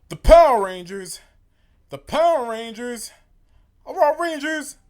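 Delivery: loud, high cheering yell; crowd shouting. Acted.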